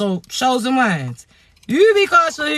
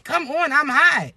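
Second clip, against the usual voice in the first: usual speaking voice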